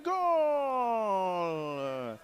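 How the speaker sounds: drawn-out goal call, falling; long goal call. Real, not acted.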